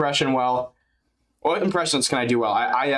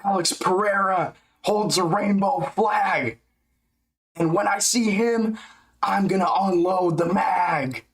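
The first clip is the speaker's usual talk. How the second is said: slow, higher hammed-up Hulk rap; Hulk rhyme voice; 5 semitones higher, slower than usual. Rapping corny rhymes for chat, hammed-up Hulk voice. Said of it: "Make up some corny ass Incredible Hulk rhymes".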